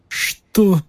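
high, snapped disbelief; sharp question. A narrator's reading. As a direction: fling the word out. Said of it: "бросил мистер Малфой".

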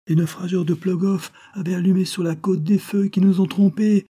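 voix plus haute, récit haletant de naufragé; matelot rescapé bouleversé. Acted. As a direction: raconter le naufrage encore sous le choc. (marin épuisé qui raconte, bouleversé)

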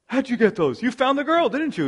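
an acted shocked father-in-law; mock startled Judah. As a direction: play him stunned and accusing.

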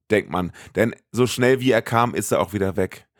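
usual speaking voice

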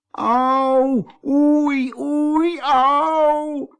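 drawn-out high yelps; high cries of pain. A narrator's reading. Kees yelps from the thistle bush, mock pain. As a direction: wail out theatrical ouches, pitched far up.

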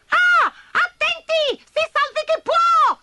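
high, loud cry of panic; panicked shout. Acted. Tiny ants shout in panic at the giant. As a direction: scream for everyone to run. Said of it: "gridarono tutte insieme".

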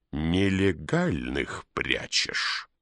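slow, cold interrogating; icy voice. A narrator's reading. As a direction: chill every word, slowly. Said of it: "ледяным голосом произнёс пристав".

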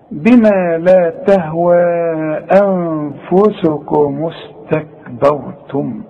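slow recitation, all of استكبرتم light except the heavy r; light-lettered recitation. Shown as a demonstration. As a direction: recite بما لا تهوى أنفسكم slowly with thin letters. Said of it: "فاحنا نرقق كل الحروف دي". (تلاوة بطيئة بترقيق الحروف ما عدا راء «استكبرتم»)

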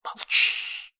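a menacing hissed whisper, slowing; angry hiss. A narrator's reading. The witch silences the narrator with an angry hiss. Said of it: "и зло прошипела".

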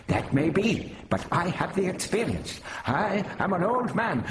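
put-on high-pitched protesting old man; Prapillus, indignant. Prapillus pulls rank on the Doctor, indignantly. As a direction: insist on your seniority, pitched high.

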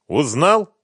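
high, loud sneering question; mock him openly. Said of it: "насмешливо осведомился Диланов".

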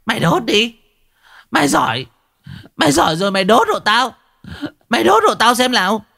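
high-pitched scolding dare; furious village woman daring him on; defiant challenge. A narrator's reading. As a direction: taunt him, then launch into a fast tirade. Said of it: "Mụ Mai thách thức".

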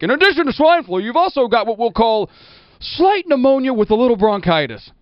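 high cartoonish doctor voice; a goofy cartoon doctor; exaggerated cartoon intonation. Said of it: "talks like a cartoon character".

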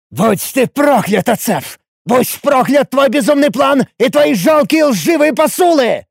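furious high-pitched roar; бешеный рёв. A narrator's reading. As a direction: roar curses like an enraged bull. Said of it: "взревел я бешеным туром". (взреветь бешеным туром)

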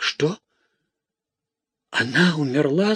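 high-pitched shocked question; alarmed cry without much volume. A narrator's reading. Theodore fears Matilda has died, crying out. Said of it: "закричал Теодор".

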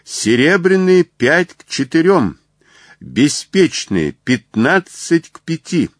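high-pitched racecourse odds calls; shouts from the stands. A narrator's reading. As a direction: call each bet out to the crowd. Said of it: "неслось с трибун".

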